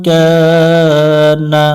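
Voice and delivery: কানা sung with the কা held for one alif; one alif stretch. Shown as a demonstration. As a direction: hold the vowel of কানা for one beat. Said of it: "এক আলিফ টান দিয়ে পড়বো". (কানা এক আলিফ টেনে)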